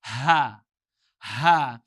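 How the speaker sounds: "Ha, ha." breathed loud like a pant. Shown as a demonstration. Teacher demonstrates the panting ha. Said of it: "there's a pant in it".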